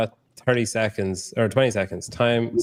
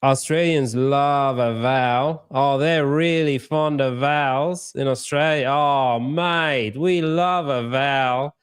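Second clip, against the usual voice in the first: broad Australian accent with long, drawn-out vowels; slow, higher, vowel-stretching Aussie caricature; 4 semitones higher, slower than usual. Shown as a demonstration. Host showing how Australians love their vowels, broad Australian accent. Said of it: "the Australian accent".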